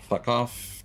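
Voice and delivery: ordinary delivery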